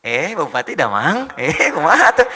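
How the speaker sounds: drawn-out, sing-song Sundanese accent; local Sundanese speaker. Shown as a demonstration. Greeting in the local language for the crowd, sing-song Sundanese accent. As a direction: stretch it slowly like a Sundanese local. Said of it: "kayak orang lokal lagi".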